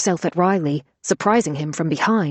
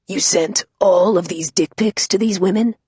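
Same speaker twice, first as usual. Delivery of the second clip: lightly rasped disgusted Batman; a hint of gravelly Batman scorn; 3 dB louder than usual. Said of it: "cleared her throat and rasped".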